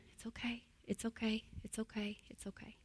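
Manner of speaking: quiet reassurance sinking into a whisper; soft soothing words fading into a whisper. Shown as a demonstration. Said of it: "help that puppy dog go from to".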